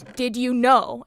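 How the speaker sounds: mock an angry teacher, leaning hard on KNOW; retold pissed-off teacher. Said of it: "she looked really pissed at me".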